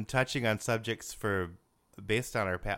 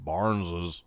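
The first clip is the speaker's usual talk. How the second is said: low bass phone greeting; grandpa's phone voice; 6 semitones lower than usual. Retold or imitated. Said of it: "He had that great phone voice".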